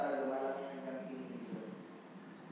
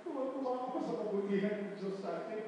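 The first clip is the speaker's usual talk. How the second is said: quoting himself; 7 semitones higher, faster than usual. Retold or imitated. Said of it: "sabi niya".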